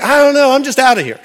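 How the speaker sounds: loud, high, shaken reply; frantic exclaim. Retold or imitated. Him answering his friend while walking off, loud and shaken. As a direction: blurt it out, rattled and leaving.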